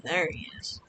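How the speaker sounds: unvoiced hushed whisper. Real, not acted. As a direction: whisper so the monkey won't hear. Sneaking up on monkey.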